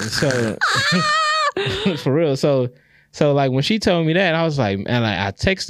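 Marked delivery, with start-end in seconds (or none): 0.6-1.9 cracking up in a high cackle; high-pitched laugh. Real, not acted. Cracking up at own joke.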